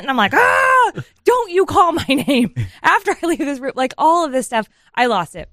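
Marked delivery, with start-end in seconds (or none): 0.3-2.5 high, loud cry into slow warning; mock exasperated shout. Mother retells losing it at bedtime, exasperated mock shout. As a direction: burst out, then drag out the scolding.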